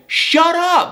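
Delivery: sharp shout of shut up. Acted. Vlogger scolds Natalie through the screen, high mock shout. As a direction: pitch jumps high on a sudden mock shout.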